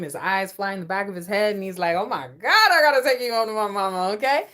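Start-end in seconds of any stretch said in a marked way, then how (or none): 1.9-4.5 loud, exaggerated infatuated cry; mock smitten outburst. Man in the bedroom deciding you meet mama, mock smitten. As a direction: act lightly overwhelmed, getting louder and quicker. Acting the man's smitten reaction.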